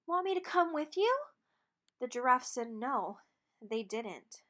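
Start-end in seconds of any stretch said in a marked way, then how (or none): 0.0-1.3 higher, hopeful call; called out hopefully. Acted. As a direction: call after them, hoping to be asked along. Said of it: "He called out hopefully".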